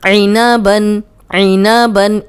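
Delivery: ऐनाबन with आइन pushed back from the throat; ऐनाबन with a throaty ain; clear ain sound. Shown as a demonstration. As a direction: press the tongue back and bring आइन from the throat. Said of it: "आइन की practice की ज़रूरत है।".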